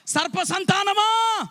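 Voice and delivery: high, drawn-out denouncing cry; preacher's rebuke. Acted. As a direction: cry it out slowly, like a prophet's rebuke. Hurls biblical rebuke at crowd. (పైస్థాయిలో సాగదీసి గద్దింపు)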